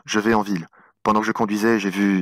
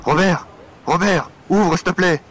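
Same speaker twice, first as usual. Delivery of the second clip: louder, higher urgent calls; calling out; 6 semitones higher, 4 dB louder than usual. Acted. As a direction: shout through the door. Banging on door, calling.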